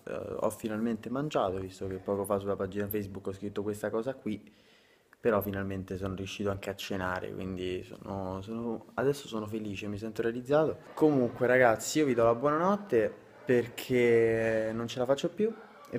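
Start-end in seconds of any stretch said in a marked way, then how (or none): 0.1-10.8 soft late-night talk; hushed and tired; quiet voice. Real, not acted. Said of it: "dovrò parlare anche a bassa voce".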